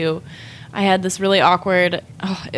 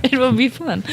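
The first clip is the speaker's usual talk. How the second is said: put-on a laughing, light Russian accent, pitched higher; playful mock Russian photographer; 5 semitones higher than usual. Joining the mock of the photographer in a light Russian accent. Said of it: "why we just gave him that accent".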